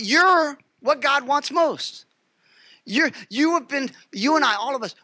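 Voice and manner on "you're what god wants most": slow emphasis. Acted. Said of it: "emphatic slow repeat".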